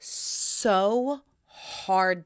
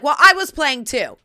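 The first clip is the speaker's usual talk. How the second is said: louder teasing defense; teasing her husband back, louder; playful protest; 4 semitones higher, 11 dB louder than usual. Retold or imitated. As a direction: insist you were only playing, louder. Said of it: "And I was like".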